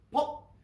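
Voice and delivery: a sharp, high "pop" noise; imitated pop. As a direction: mimic the brake popping off, high and punchy. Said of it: "all I've heard was".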